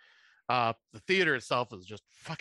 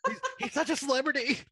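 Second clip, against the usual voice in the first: mock gushing fan; 13 semitones higher than usual. Mock fan gushing about celebrity.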